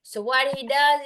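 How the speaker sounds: usual speaking voice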